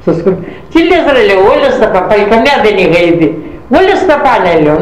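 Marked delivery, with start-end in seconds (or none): 0.7-1.2 much higher, exasperated outburst; indignant retort. Retold or imitated. To mother-in-law about school, high indignant outburst. 1.5-4.5 high, quick insistent protest; indignant protest. Retold or imitated. Arguing with mother-in-law about school, high insistent protest.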